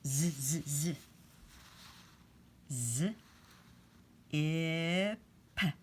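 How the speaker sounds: ordinary talk, nothing marked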